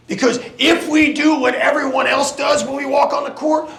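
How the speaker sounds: rising, shouted locker room speech; halftime pep speech. Acted. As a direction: fire the team up. Coach building to shouted pep talk.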